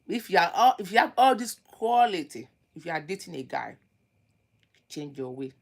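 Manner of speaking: ordinary delivery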